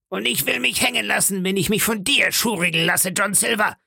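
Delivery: high-pitched defiant mutineer voice; rebellious pirate. Acted. Pirate refuses to be bullied by his leader, defiant.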